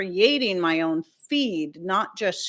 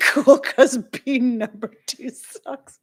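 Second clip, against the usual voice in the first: slow words wobbling into teary laughter; laughing till crying; 6 semitones higher, 5 dB louder, slower than usual. Real, not acted. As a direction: crack up mid-sentence. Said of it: "you guys are cracking me up".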